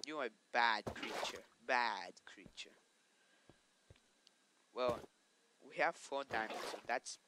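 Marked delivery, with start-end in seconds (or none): 0.1-1.4 louder, stern telling-off; mock scolding. Real, not acted. To the attacking mob, a mock stern scolding. 1.7-2.8 breathy hiss of a scold; whispered scolding. Real, not acted. To the mob again, a slow whispered scolding.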